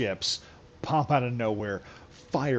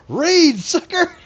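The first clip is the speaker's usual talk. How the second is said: much higher, much louder manic shrieking yell; Uncle Ruckus yelling; 12 dB louder, much slower than usual. Retold or imitated. Uncle Ruckus smashing a possessed man with a book, shrieking high yell. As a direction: scream it like a crazed zealot swinging a book. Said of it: "in comes Uncle Ruckus ... he goes".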